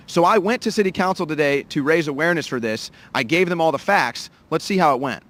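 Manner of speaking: loud on-camera report to the lens; reporter stand-up. Acted. Reporter outside City Council telling viewers the story, loud and driving. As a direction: announce the story like a field reporter.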